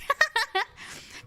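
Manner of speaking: high-pitched giggling burst; real high laugh. Real, not acted. Streamer amused by a Boston-accented VTuber, real high laugh.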